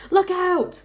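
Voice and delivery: high, loud warning cry; alarmed shout. A narrator's reading. Policemen and firemen seeing an ugly face, alarmed shout. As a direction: yell a sudden warning to everyone. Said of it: "all started shouting at once".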